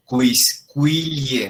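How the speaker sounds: QUISQUILIE with each qu said as kw. Shown as a demonstration. Latin teacher models sounding the u, careful kw in QUISQUILIE. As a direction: say "kwis-kwi-lie", sounding the u in quisquilie. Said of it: "se lee por separado la letra Q y la U".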